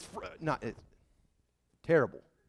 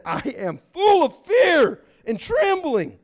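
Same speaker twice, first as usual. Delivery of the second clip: higher, louder, slow trembling cry; fearful Moses; 10 semitones higher, 8 dB louder, slower than usual. Acted. Moses at the mountain, voiced high and slow in fear. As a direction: voice Moses overwhelmed with dread. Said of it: "Moses said".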